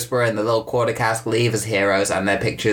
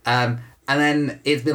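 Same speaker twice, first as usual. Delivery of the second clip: ordinary delivery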